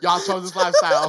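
high, loud, put-on preachy voice; mock preacher. Mocking religious relatives in a loud preachy voice. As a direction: scold like a hellfire church lady.

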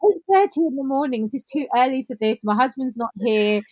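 high, loud, overwhelmed protest; acted-out overwhelm. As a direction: reenact a mildly frantic outburst. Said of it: "I was like a crazy woman".

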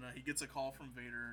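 usual speaking voice

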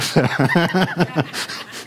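light laugh trailing off; amused little laugh; chuckle. Real, not acted. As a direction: chuckle at the awkward moment. Chuckles after "now what?.

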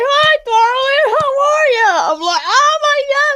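very high, loud, drawn-out wail breaking into laughter; overwhelmed squeal. Retold or imitated. Fan bear-hugged by her hero, overwhelmed high wailing squeal. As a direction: imitate being too overcome to speak properly. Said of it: "I couldn't even speak proper".

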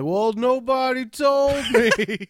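high, slow, playfully dumb whine; clueless excuse. Retold or imitated. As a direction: play the innocent coworker, drawn out. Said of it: "be like".

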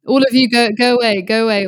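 mock shooing. Host telling listeners to go watch the guitarist, playful loud shooing.

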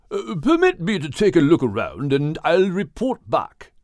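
a hint of a posh English whale; proper English whale. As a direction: play Craddock, politely formal. Craddock whale voice.

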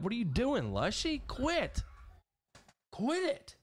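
high exasperated command; scolding the dog. Real, not acted. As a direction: tell the dog to quit. Scolding dog during livestream.

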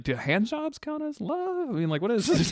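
falsetto mock question; squeaky high question. Joking quote.

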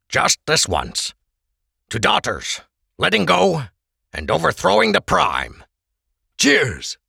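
lightly voiced Krebs raising a toast; a barman proposing a toast.

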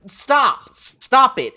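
exasperated stop. Real, not acted. Host fed up with ESPN baiting Mike Leach, loud exasperation. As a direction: tell them to knock it off.

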